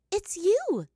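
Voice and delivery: loud, high surprised cry; startled recognition, loud; surprised exclaim. A narrator's reading. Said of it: "the other earthbender exclaimed".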